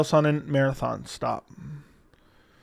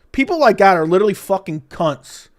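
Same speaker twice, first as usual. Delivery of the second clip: ordinary delivery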